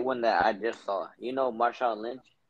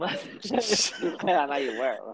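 laughing mock echo; 7 semitones higher, much slower than usual.

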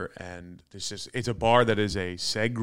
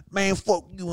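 higher-pitched mock gangster talk; wannabe gangster; 6 semitones higher than usual. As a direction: lightly imitate a rich kid acting tough. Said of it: "thought he was a gangster".

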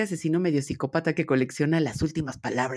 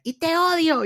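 much higher, louder mock "te odio", thrown like a tantrum; "te odio" shrieked up high like a furious little girl; childish hate shout; 12 semitones higher, 4 dB louder than usual. Little girl yelling at her dad, in a high mock tantrum. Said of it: "el primer "te odio" que te dice un hijo".

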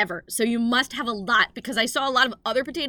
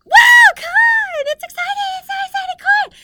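much higher, louder hammed-up cheering; mock kids cheering; 14 dB louder than usual. Audience imitated cheering at corn jokes, mock excitement. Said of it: "And they're going".

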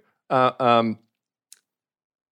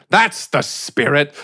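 gruff sheriff; 3 dB louder, slower than usual. Acted. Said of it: "Mazelston says".

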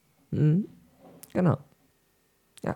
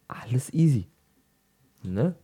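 whispering; 4 semitones higher than usual. Shown as a demonstration. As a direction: whisper the same words. Repeated as whisper.

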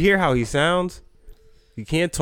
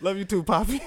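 laughing quote; 7 semitones higher than usual. Retold or imitated. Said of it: "He said".

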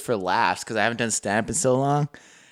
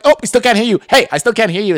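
high, loud impatient; mock classmates; 10 semitones higher, 6 dB louder, faster than usual. Classmates on the call, high and loud. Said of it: "they're like".

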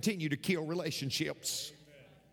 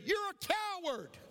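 very high mimicked taunt; echoed holler; 11 semitones higher than usual. Echo answering the boy's taunt, high holler. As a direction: throw the same high call back. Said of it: "And the voice said".

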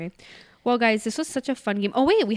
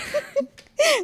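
squealing burst of laughter; high laugh; 4 dB louder than usual. Real, not acted. Laughing at her own letter, high squeal.

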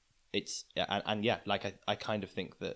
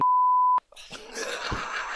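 loud laugh; 7 dB louder than usual. Real, not acted. Co-host laughs hard after he names the friend on air. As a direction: burst out in a high, loud laugh. Podcast guest laughs at naming joke.

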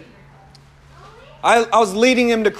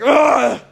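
loud, higher upset grunt; imitated grunting; 6 semitones higher, 5 dB louder than usual. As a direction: mimic an annoyed grunt over the phone. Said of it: "He's just grunting".

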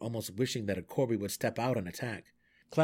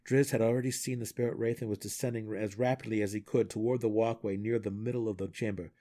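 plain reading voice

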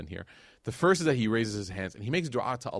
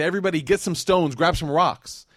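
loud, higher mob command; crowd shouting orders; 6 semitones higher, 8 dB louder than usual. Retold or imitated. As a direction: bark it out like a rallying crowd. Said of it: "and they say".

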